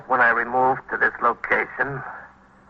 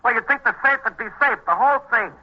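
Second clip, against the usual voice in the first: agitated disbelief; 10 semitones higher than usual. Acted. Shopkeeper tells the police captain his safe is gone, agitated and much higher. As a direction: pitch jumps higher, worked up over the loss.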